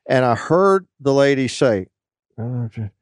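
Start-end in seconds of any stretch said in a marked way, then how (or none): 2.4-2.9 muttering to self. Retold or imitated. Phone agent noting a customer's threat to cancel, muttering. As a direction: mutter it quickly under your breath. Lady murmurs as she notes complaint.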